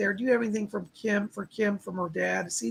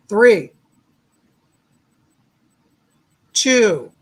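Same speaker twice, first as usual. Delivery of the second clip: counting out loud; 10 dB louder than usual. Acted. Counting down the meditation.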